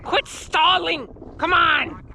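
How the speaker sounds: high, slow mock rant; hammed-up cursing sailor; mock angry sailor. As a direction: ham up the fury at a stubborn gun. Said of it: "I'm a freaking angry sailor".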